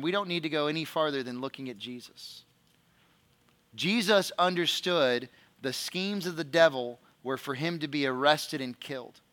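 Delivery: normal talking voice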